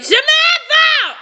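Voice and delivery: high, drawn-out call; yelling. A narrator's reading. Jason calling for Samantha, yelling. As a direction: holler the name slowly through the house.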